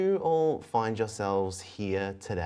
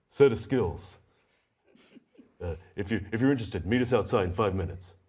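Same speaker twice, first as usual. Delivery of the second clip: a touch deeper telepathic voice; deeper second voice; faster than usual. Acted. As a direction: take over the message, a little deeper. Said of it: "another voice takes over, deeper than the first".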